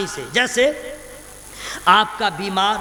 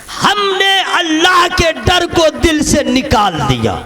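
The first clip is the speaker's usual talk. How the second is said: raised, rising sermon voice; fiery preaching; 7 semitones higher, 4 dB louder than usual. Acted. Preacher stirring the crowd, rising. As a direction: build the preaching louder.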